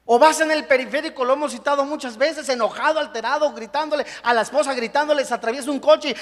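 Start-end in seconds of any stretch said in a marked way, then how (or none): none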